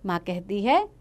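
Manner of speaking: ordinary delivery, nothing marked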